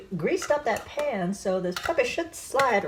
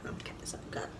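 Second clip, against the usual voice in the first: whisper; 17 dB quieter than usual. Real, not acted. Whispered aside.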